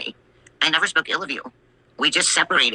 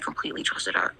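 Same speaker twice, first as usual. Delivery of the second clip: whisper; 8 semitones lower, 9 dB quieter than usual. Real, not acted. Confiding aside.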